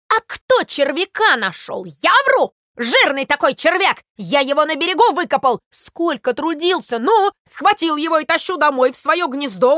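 loud, high-pitched tirade; worked-up, shouted outrage; indignant ranting. Acted. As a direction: pour out your grievance as a wronged sparrow. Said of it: "орал Воробей Воробеич".

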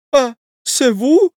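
high, slow, loud cry of feigned delight; joyful cry. Acted. Wife greeting her husband, loud joyful cry. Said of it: "закричала ... с неподдельной радостью".

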